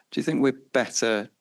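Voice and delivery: ordinary talk, nothing marked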